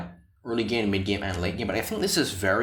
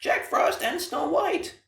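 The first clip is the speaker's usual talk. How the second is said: voice crack; much slower than usual. Real, not acted. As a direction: voice cracking up high and slow. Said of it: "that voice crack".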